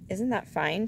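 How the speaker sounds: ordinary delivery, nothing marked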